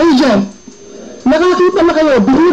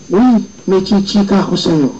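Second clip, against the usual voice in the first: teasing secret hint; 6 semitones lower than usual. Retold or imitated.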